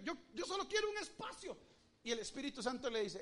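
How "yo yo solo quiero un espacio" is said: pleading woman. Acted. Woman's plea voiced in sermon.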